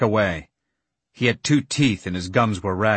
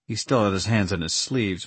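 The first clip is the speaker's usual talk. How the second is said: ordinary delivery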